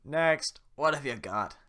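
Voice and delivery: loud barking guard; head guard. Acted. Head guard calls Geppetto forward, loud bark. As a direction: call them forward. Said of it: "Head guard".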